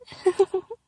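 high, short soft giggle. Acted. Teasing the listener she watches, soft high giggle. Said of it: "Maybe I giggle softly".

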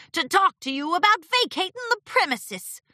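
high-pitched grumbling complaint; grumbled. A narrator's reading. Martingale demanding that Discord move out, grumbling. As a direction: grumble it as a disgruntled pony. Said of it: "Martindale grumbled".

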